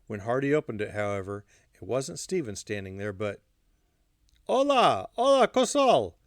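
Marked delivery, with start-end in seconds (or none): 4.5-6.2 high, loud astonished exclamation. A narrator's reading. Hardy finds Alla at his door, astonished. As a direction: cry out in sharp surprise. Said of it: "Hardy said, astonished".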